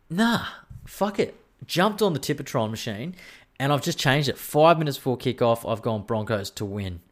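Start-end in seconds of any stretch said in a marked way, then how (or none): 0.1-1.4 mock reckless decision. Watching the build-up before kickoff, deciding on a whim, high and careless. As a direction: fling it away like a gambler's whim. Retold snap tip change.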